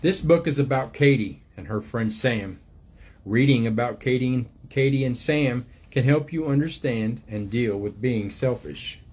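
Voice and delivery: gentle storybook reading voice, unhurried; reading to children. Acted. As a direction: read it like a picture book to little ones. Reads book aloud to children.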